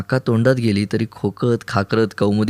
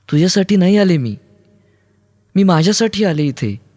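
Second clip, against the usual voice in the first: high-pitched, defiant outburst; heated declaration; 8 semitones higher, 3 dB louder than usual. Acted. Kaumudi tells Aruni she came for herself, heated.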